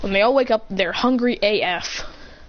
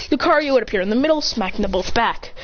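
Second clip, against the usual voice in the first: ordinary delivery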